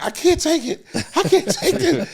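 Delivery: high voice cracking with laughter; laughing helplessly. Retold or imitated. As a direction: squeal it through laughter. Genuine laughing hard.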